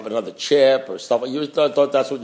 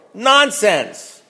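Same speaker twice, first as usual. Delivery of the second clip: dismissive; 6 semitones higher than usual. Real, not acted. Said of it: "claiming he cannot master alcohol".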